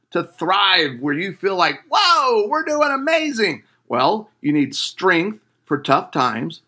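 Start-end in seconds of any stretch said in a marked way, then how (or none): 1.9-3.6 high, bright burst of delight; excited whoa. Pretend. Couple imagining their thriving relationship, excited high whoa. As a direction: pretend couple thrilled, voice jumping high.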